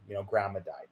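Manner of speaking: low, soft, understated delivery; subdued news-breaking. Retold or imitated. Cousins telling the speaker grandma died, subdued. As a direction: quietly pass on sad news.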